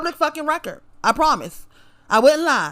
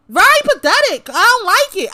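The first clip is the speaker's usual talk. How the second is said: much higher, louder burst of contempt; furious high shout; 8 semitones higher, 5 dB louder than usual. Real, not acted. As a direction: spit it out high and loud. Climax of angry rant.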